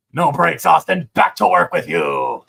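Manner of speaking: loud joking taskmaster order; bossy boss voice. Pretend. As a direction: bark it like a playful foreman. Joking bossy line after laughing.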